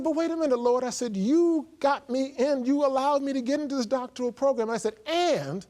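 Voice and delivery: rising indignant complaint, slowed on the first words; exasperated arguing with the Lord; getting mad. Retold or imitated. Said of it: "I'm getting mad with the Lord now".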